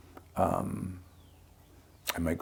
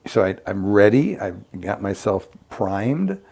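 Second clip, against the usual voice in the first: normal talking voice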